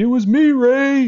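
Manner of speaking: high, loud, drawn-out yell; retold yell. Sniper yelling at the teammate he just shot, loud high yell. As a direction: yell it out high like a gleeful opponent calling across. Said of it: "he yells at me".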